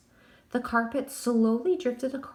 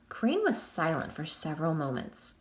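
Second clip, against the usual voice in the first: plain reading voice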